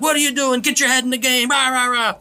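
high, loud imitation of a parent yelling at his kid from the stands; mock sideline yelling. Parent yelling at his son from the sidelines, mock loud. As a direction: holler it like a pushy parent in the bleachers.

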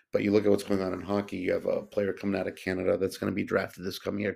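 usual speaking voice

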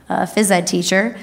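ordinary talk, nothing marked

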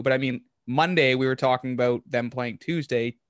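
ordinary talk, nothing marked

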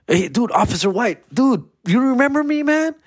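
high-pitched, incredulous plea for recognition; eager appeal. Retold or imitated. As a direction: reenact a driver hailing someone, pitch climbing. Greets cop who ticketed him.

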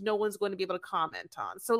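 normal talking voice

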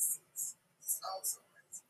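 breathy whisper, almost no voice; whispered aside. Real, not acted. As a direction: drop to a secret whisper. Aside repeated, then said plainly.

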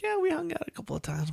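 high, fast, soft fake-casual; high casual cover. Covering to the daughter, high quick casual. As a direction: brush it off lightly up high. Quoting himself to mom.

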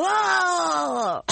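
big high howling wolf cry. Pretend. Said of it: "my wolf voice is killer".

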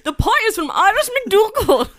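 high, loud laughing Irish accent; loud giggly Irish accent. Shown as a demonstration. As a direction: push through the Irish line while laughing. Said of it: "Kendall, you do it".

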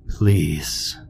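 a lightly voiced ghost, a touch low and airy; a hint of an unearthly child ghost pleading; light raspy ghost voice. A narrator's reading. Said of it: "The voice was light and raspy".

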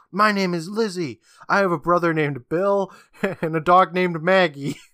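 lightly acted defiant announcement; defiant declaration. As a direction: declare it proudly, breaking free. Captive girl breaks free, defiant declaration.

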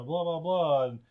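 ordinary delivery

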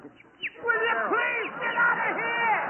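high, drawn-out comic outburst; exasperated yell. Acted. Costello throwing the butler out, exasperated and high. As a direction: wail the order slowly at a high pitch.

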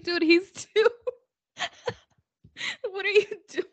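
high, breaking into laughter; laughing and barely getting it out; giggling through a mock scold. Real, not acted. Laughing while retelling.

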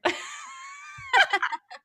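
high squealing laugh bursting out; burst of laughter. Real, not acted. Laughing at her own joke about being paid. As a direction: let a shrieking laugh break out.

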